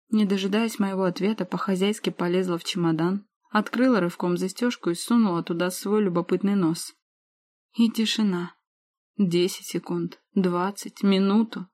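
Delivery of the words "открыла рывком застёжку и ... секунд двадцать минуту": plain reading voice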